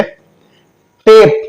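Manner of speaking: टेप with a drawn-out "ay"; long A. Shown as a demonstration. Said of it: "ए का उच्चारण ए किया". (टेप में लंबा ए)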